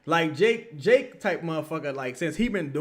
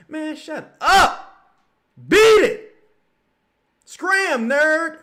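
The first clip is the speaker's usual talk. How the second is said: much higher, loud, slow dismissal; mock shout; 12 semitones higher, 11 dB louder, much slower than usual. Speaker chasing Jake out of the spaces, loud high mock shout. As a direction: shoo him off with a high, loud yell. Shooing Jake away, retold.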